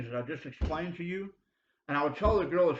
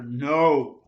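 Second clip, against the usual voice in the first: loud no; 10 dB louder than usual. Real, not acted. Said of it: "that's the answer".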